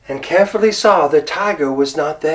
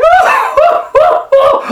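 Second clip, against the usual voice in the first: very high loud monkey cackle; shrieking monkey laugh; 9 dB louder than usual. Acted. Monkey laughing at the tiger, high shrieking laugh. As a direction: howl with laughter.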